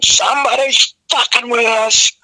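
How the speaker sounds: high, slowing mock rant; retold yell. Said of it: "And I'm yelling".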